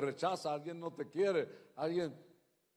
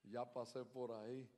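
usual speaking voice

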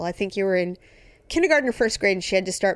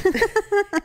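high laugh; 13 semitones higher than usual. Real, not acted. Laughing at own joke.

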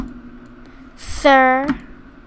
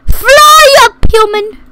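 shout; 7 semitones higher, 4 dB louder than usual. Pretend. Shouting in game.

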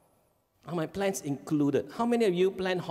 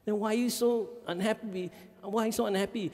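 puzzled parent; 8 semitones higher than usual. Retold or imitated. Parent asking, high pitch.

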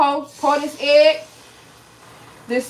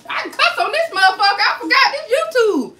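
laughing at herself, pitch jumping higher; 9 semitones higher, 3 dB louder than usual. Real, not acted. Cook on a livestream realizing she can swear, laughing. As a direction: giggle through it in delighted disbelief.

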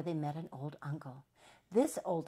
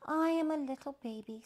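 put-on high little baby voice; 9 semitones higher, 3 dB louder than usual. A narrator's reading. Baby introducing himself to the hen, high childlike voice. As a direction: say it high, as a small child would. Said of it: "said the little baby".

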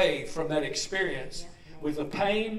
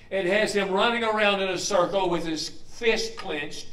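usual speaking voice

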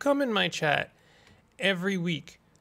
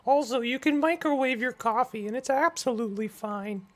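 puppet voice; 8 semitones higher than usual. Pretend. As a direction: go way up into a puppet voice and get worked up. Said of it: "saying just about anything in my puppet voice".

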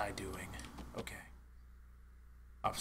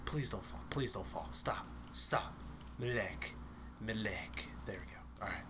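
hushed, softly pleading under the breath; near whisper; 4 semitones lower, 8 dB quieter than usual. Real, not acted. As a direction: keep it down so nobody hears. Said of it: "I don't know why I'm whispering".

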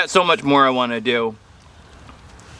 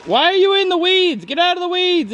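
high voice. Acted. Scolding a sparrow in high voice.

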